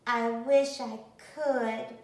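ordinary delivery

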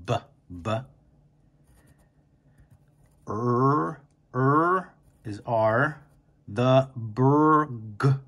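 B, b and er held, burg drawn out; slow sounding out of burger. Shown as a demonstration. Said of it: "so I just say it slowly".

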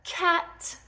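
ordinary delivery

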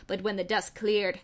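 ordinary delivery

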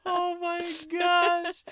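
slow high stretched oh my gosh; drawn-out exasperated. Real, not acted. Stretched reaction after laughter.

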